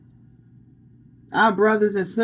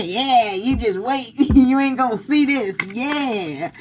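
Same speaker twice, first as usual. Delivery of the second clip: hammed-up vengeful plotter, drawing out the yeahs; mock scheming villain; 4 semitones higher than usual. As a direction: play someone gloating over a coming payback. Mimicking the vengeful plotter again.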